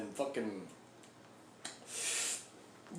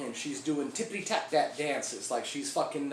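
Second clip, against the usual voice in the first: usual speaking voice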